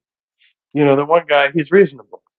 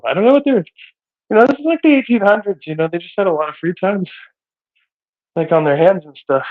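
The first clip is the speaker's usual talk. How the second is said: out of breath; 6 semitones higher than usual. Real, not acted. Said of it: "I don't even know why I'm out of breath".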